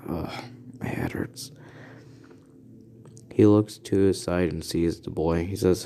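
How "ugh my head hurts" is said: put-on soft, breathless groan of a boy waking up hurt; groggy waking mutter. Boy waking in the infirmary, groggy and sore. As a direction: voice it like your head is pounding.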